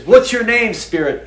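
mock demand leaping up in pitch; exorcist's challenge. Preacher imitating someone casting a demon out, challenging. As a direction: challenge the spirit, high then dropping off.

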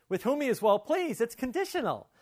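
high, loud re-enacted surprise; startled realization. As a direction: act out a sudden, indignant-sounding discovery. Re-enacts own startled discovery.

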